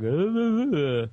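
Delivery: high, loud wordless radio-host babble; mock radio voice. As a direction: imitate a teasing take on radio voice. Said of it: "you totally do a radio voice".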